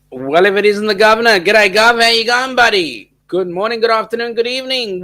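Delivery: loud, high, over-the-top welcome; hearty greeting. Real, not acted. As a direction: boom out a big jolly g'day to the governor. Host greets arriving viewer loudly.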